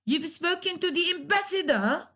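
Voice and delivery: statement tuned upward into a question at "ambassador"; rising question tone. Shown as a demonstration. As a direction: ask it by raising "ambassador". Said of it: "rise your tone".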